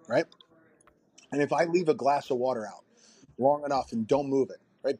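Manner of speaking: ordinary talk, nothing marked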